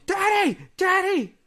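high, loud yelled cry for Dad; high-pitched toddler yell, drawn out; mock child scream. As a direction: imitate a kid screaming out in the night. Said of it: "he starts screaming".

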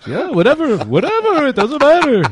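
high-pitched, dismissive mimicry; Adam impression. Co-host continuing the requested impersonation, high and dismissive. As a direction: brush it off in a high put-on voice. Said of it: "Adam voice impersonation. Do it again".

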